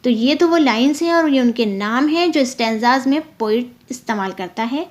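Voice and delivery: ordinary talk, nothing marked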